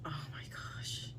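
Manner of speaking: hushed oh my gosh at the rot; whispered dismay. Real, not acted. Whispered dismay at rotten plant.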